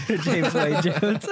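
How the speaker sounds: slow laughing repeat; laughing echo. Real, not acted. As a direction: giggle the name out. Echoing with laughter.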